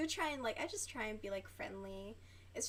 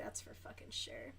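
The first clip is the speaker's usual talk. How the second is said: whispered aside; 9 dB quieter than usual. Real, not acted. Aside about Pepsi.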